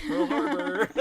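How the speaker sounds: high, loud hammed-up shout with a laugh; mock announcement. Japan personified, shouting with a laugh. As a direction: burst in cartoonishly. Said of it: "Japan's like".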